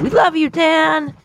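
high, slow cheer; mock chorus cheer. Shown as a demonstration. Showing listeners the chant for Dan, high slow cheer. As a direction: lead the crowd in the line. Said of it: "I want you to say it with me".

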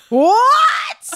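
high incredulous shriek into laughter; can't believe what she's reading; real disbelieving howl of laughter; shocked laugh. Real, not acted. Shocked at guy's bragging text.

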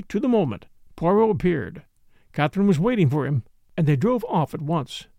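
plain reading voice

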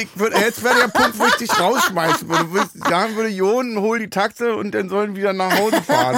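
high, laughing mock outrage. Real, not acted. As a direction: laugh through the complaint. Laughing complaint after the burning drops.

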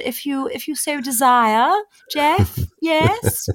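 put-on Queen's English accent, ending high. Shown as a demonstration. Showing off accents to the interviewer, Queen's English. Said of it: "a little bit of the Queen's English".

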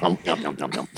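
quiet cartoon nom-nom-nom; imitated munching. Imitating partner gobbling another pill, mock munching. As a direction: gobble softly, mock chomping noises.

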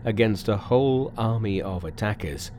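ordinary delivery, nothing marked

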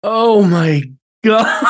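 loud, high cry collapsing into laughter; exclaiming into laughter. Real, not acted. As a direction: crack up at an awful joke. Cracks up at awful joke.

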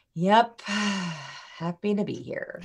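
slow, heavy sigh of boredom; deliberately boring, dragged-out delivery. Shown as a demonstration. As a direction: sag and sigh through it, uninterested. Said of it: "made my voice sound really, really boring".

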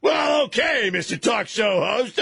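high-pitched, hammed-up pissed-off retort; mock indignant outburst. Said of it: "they get all pissed off at you".

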